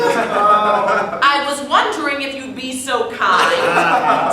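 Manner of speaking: slow, drawn-out repeat of the polite request. Acted. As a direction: drag the request out for effect. Repeats line amid laughter.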